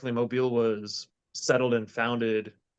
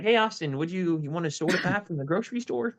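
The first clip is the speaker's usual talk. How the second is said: higher-pitched imitation Midwestern accent; 6 semitones higher than usual. Imitating family offering a drink, Midwestern accent. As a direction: mimic a relative's Midwestern accent. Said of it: "Midwestern accent".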